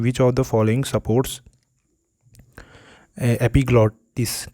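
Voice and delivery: slow, drawn-out question reading; reading a quiz question. Shown as a demonstration. Teacher to students, reading the exam question very slowly. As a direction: spell the question out slowly for the class.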